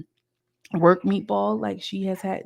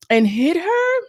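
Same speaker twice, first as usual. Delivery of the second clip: excited; 10 semitones higher, 5 dB louder, slower than usual. Real, not acted. Retelling the chair throw.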